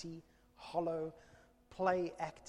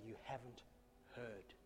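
quiet blame; 5 semitones lower, 15 dB quieter than usual. Retold or imitated. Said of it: "in blame".

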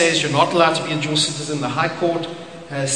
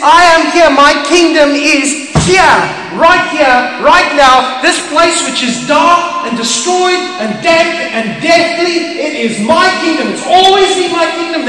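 proclaiming as God; 7 semitones higher, 3 dB louder than usual. Acted. God's voice proclaimed in sermon.

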